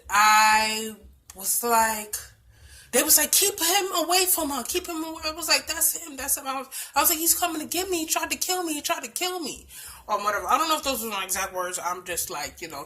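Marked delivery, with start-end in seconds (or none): 3.3-5.2 alarmed crowd. Retold or imitated. 5.7-9.7 frightened plea. Retold or imitated. Terrified victim pointing out attacker.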